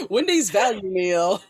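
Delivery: laughing through the words, slow and loud. Real, not acted. Host laughing with the guest about cheap meals, laughing. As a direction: chuckle it out, amused.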